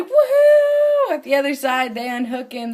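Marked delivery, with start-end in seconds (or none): 0.0-1.1 long, high, loud mock child's cheer; elated whoop. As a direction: whoop like an overjoyed kid, full voice. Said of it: "He's like".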